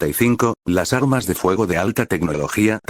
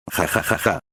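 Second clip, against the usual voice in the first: maniacal laugh. Acted. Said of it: "Hajime se rió maníacamente".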